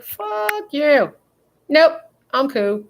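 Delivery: high, slow, sassy dog voice; voicing the dog. Owner voicing her stubborn dog refusing to come, high and slow. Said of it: "She's like".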